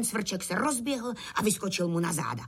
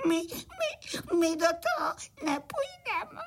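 very high, sobbing little-cricket voice; tearful whimpering; 8 semitones higher, slower than usual. A narrator's reading. Little crickets afraid of the cart, crying. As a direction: whine it through acted frightened tears. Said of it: "se však malí cvrčci rozplakali".

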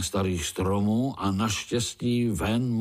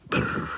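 rolled-lip shiver noise; shuddering "brr". A narrator's reading. Spiderlings shudder at uncle's rhyme, shivering "brr". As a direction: trill a short "brr" of dread. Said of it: "otřásli se pavoučci".

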